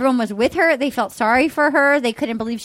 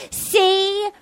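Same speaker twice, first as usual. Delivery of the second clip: mocking impression; 7 semitones higher, slower than usual. Mocking ex-contestant's insistence.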